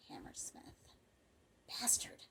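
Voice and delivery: cursing under the breath; bitter whisper. A narrator's reading. Calloway cursing Hammersmith over the closure, bitter whisper. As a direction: whisper it, hardly any voice.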